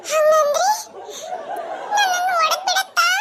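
high worried voice; alarmed. Acted. Alone, fearing conscience will hit, high anxious voice.

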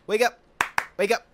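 loud high wake-up calls; urgent shout. Acted. Rousing a fallen friend with loud calls. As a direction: shout him awake.

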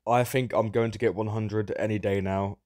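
usual speaking voice

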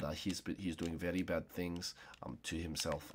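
hushed hammed-up menace; mock sinister. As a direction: play a quiet creepy villain. Mock menacing threat to find friend.

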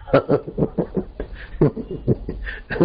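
long-suppressed laugh bursting out; soft airy laugh breaking free. Acted. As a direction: laugh like months of it were held in. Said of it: "दबी हुई हंसी कठियारा के अंदर से उछली".

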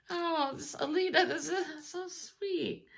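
high, soft, tearful gratitude; tearing up. Real, not acted. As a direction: softly choke up over a sweet gift. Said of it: "I'm already tearing up".

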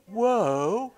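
high, awed whoa; amazed whoa. Retold or imitated. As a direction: gasp of wonder up high. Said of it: "I went".

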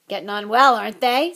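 loud, happy boast; happily. Acted. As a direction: beam with pride out loud. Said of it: "said Hagrid happily".

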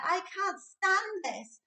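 high, slow, complaining; fed-up Mister Bear. A narrator's reading. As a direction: drag it out like a sleepless father. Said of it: "said Mister Bear".

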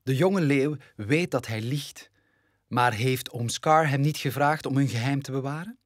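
plain reading voice

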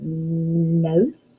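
long humming N at the start of nose; stretched first sound. Shown as a demonstration. Teacher quizzing kids on nose, drawn-out first N. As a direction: hold "nnn", then finish nose as a question. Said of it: "hear at the beginning of the word nose".

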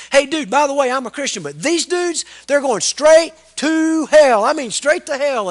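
loud, high rant, stretched slowly on straight to hell; light into his buddies at full volume; a teenager hollering that his friends are hell-bound; mock tirade. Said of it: "he just lit into him".

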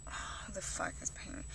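near-whispered irritated aside; muttered aside. Real, not acted. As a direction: mutter it very softly to yourself.

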